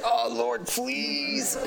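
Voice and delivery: high thin pleading cry; hammed-up retold plea to God; mock pleading. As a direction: beg the Lord in a small high voice. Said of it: "I was pleading".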